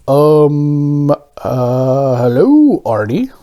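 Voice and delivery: flustered stammering man. A narrator's reading. Mister Bing greets the donut, stammering nervously. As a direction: trip over the words nervously. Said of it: "Mister Bing stammered".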